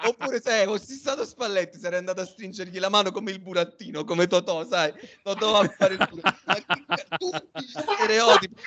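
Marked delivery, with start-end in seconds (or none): none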